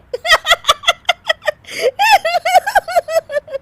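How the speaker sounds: long, very high laughing fit; uncontrollable laughter. Acted. Daughter-in-law laughing at the spilt spoon, uncontrollable laughter. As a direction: laugh on and on helplessly. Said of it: "Anshu फिर से हंसने लगती है।".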